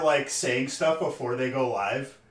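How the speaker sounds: ordinary talk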